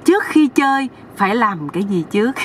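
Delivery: usual speaking voice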